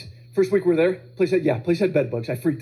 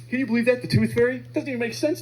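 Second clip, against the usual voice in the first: put-on high, incredulous kid voice; scornful older child; 6 semitones higher than usual. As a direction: scoff like a ten-year-old who knows better. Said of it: "what a little baby his brother is".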